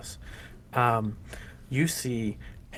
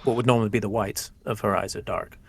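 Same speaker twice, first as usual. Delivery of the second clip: ordinary talk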